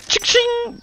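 high, sung-out ching ching like a till; cash register ching. Pretend. Cheering a sale, high cash register ching.

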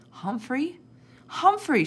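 rising question, then a high loud cry; alarmed cry. A narrator's reading. Sophie finds the sleeping hut empty, crying out. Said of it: "she cried".

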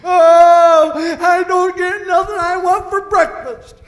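high, loud whiny moan; mock whining. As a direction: whine like a spoiled complainer. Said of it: "murmuring and crying and moaning and groaning".

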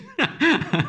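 sudden high burst of laughter; laughing. Real, not acted. Laughs at own confusion.

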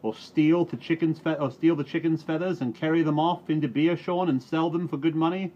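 high, loud accusing farmer; angry shout. Acted. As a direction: rage at the farmhand. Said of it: "shouted the voice called Mus' Reuben".

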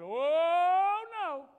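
hammed-up high, loud, slow oh no; mock a doubter's panic, drawn out. Preacher mocking doubters who fear big problems, mock panic.

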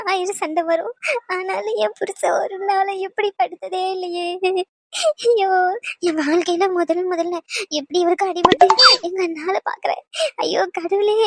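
sobbing lament in broken phrases; tearful wailing. Acted. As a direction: cry out to God over your hurt husband. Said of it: "இப்படி அழுதுகிட்டே இருந்தேன்னு".

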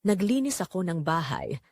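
normal talking voice

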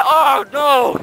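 high alarmed cry. Real, not acted. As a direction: panic before the crash. About to hit a pole.

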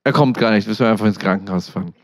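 low, fast, dismissive radio voice; doctor's reply. Retold or imitated. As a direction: rattle it off flatly like a tired doctor. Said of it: "was der Notarzt sagt".

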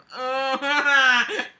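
loud high giggle. Real, not acted. Laughing at the bedroom line, loud giggle. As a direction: burst out giggling.

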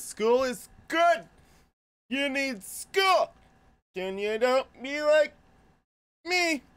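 high, loud, slow silly voice; weird goofy voice. Pretend. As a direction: squeal it out in a drawn-out goofy voice. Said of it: "so he's getting weird now".

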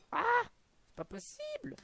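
high shocked shriek. Real, not acted. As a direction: yelp in disbelief. Shock at a kill in game.

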